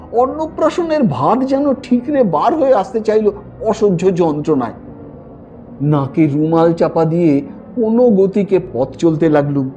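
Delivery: ordinary delivery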